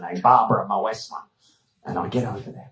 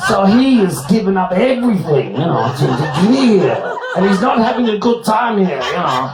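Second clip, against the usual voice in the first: mock Austrian woman; 9 semitones higher, 4 dB louder than usual. Babsy explains stalker, high, laughing.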